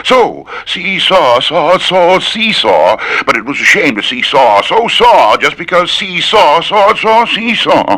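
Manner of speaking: a tongue twister climbing into a squeak. Shown as a demonstration. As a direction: push the pitch up as you go. Twister repeated higher and higher.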